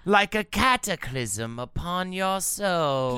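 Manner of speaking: slow, doom-laden prophecy; ominous prophet voice. Acted. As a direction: intone it like a doomsayer.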